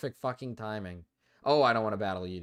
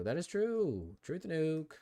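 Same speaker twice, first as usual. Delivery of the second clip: high, swooping "true"; mock-emphatic high-pitched confirmation; playful agreement; 7 semitones higher, slower than usual. Real, not acted. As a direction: repeat it high and drawn out.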